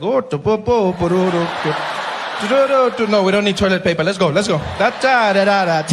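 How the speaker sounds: imitating Zuma. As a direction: babble like a slick politician. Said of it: "I'd be like".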